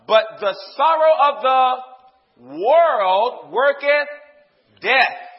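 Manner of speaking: loud, slow scripture proclamation; full-force preaching. Real, not acted. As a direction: proclaim the verse, stretching the key words.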